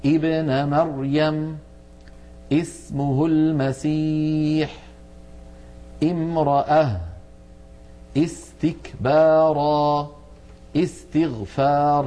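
each word drawn out slowly, the opening hamza clearly as i; teacher slowly sounding out each example word; slow demo of examples. Shown as a demonstration. Teacher shows students the kasra on hamzat al-wasl, slow and clear. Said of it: "تكون همزة الوصل في الأسماء مكسورة دائما".

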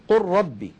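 قل ربي said as "قرّبي", the ل merged into ر; assimilated lam. Shown as a demonstration. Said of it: "وإنما يقولون".

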